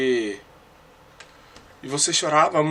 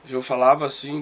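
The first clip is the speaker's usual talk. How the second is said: ordinary delivery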